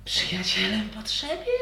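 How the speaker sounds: high, distant otherworldly voice; eerie voice in head. Acted. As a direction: speak from inside their minds. Creature voice in head.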